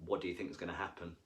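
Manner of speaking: ordinary delivery, nothing marked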